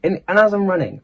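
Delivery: ordinary talk, nothing marked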